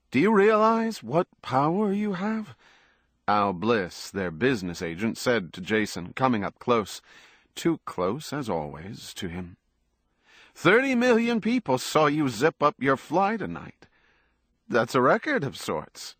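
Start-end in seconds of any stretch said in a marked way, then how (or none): 0.1-2.6 much higher, excitable agent voice; panting business agent. A narrator's reading. Agent crowding his star, high excitable voice. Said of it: "Al Bliss said in his dog-like, panting voice". 10.6-16.2 high, excitable hype; panting business agent. A narrator's reading. Agent marvels at the TV audience, high excited voice. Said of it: "in his dog-like, panting voice".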